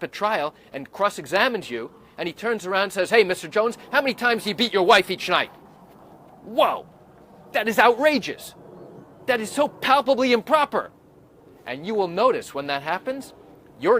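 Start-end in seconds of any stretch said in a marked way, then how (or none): 3.1-5.5 loud, accusing lawyer question; mock lawyer accusation. Defense lawyer grilling the witness, loud and accusing. 6.4-10.9 loud, higher indignant protest; outrage. Real, not acted. Lawyer reacting to the improper question, indignant outrage.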